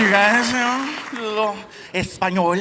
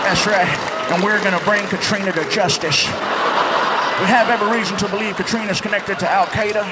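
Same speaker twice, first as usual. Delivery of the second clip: president impression.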